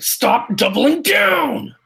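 high, loud and slow, every word dragged out; stern warning. Retold or imitated. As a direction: lecture the reckless newbie slowly. Annoyed player scolding him.

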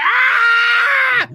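long, high, loud sustained scream; imitated scream. Retelling what played on the store owner's phone, imitated loud scream. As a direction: mimic women screaming at full volume.

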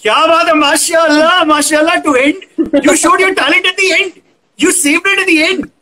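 high, thrilled praise; excited. Real, not acted. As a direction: burst out in delighted admiration. Host reacts to guest's closing line.

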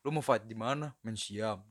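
low, soft, laid-back reply; quietly casual, voice dropped low; easygoing friends. Acted. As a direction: shrug it off like relaxed mates. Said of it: "teman-teman juga kayak".

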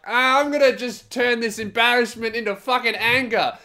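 loud, high imitation of an angry drunk; mock drunken anger. Comedian acting out the embarrassed drunk working himself up, loud and high. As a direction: blurt it as a worked-up drunk turning on people. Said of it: "he's really drunk and really embarrassed".

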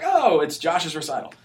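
high, loud imitated crowd reaction; mock excited realization. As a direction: imitate people cheerfully catching on.

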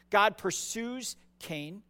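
usual speaking voice

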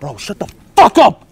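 loud, sharp shut-up; angry snap. Acted. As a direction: bark it at the suitcase. Snapping at suitcase voice.

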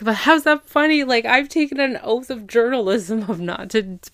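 sudden high, loud panicked outburst; freaking out. Retold or imitated. Kate panics to Telly about her journalism oath, in a high, loud outburst. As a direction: blurt it out in a hammed-up panic. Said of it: "Kate freaks out".

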